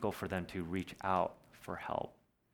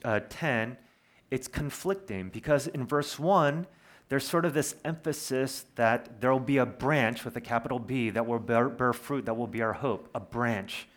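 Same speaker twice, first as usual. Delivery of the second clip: ordinary talk, nothing marked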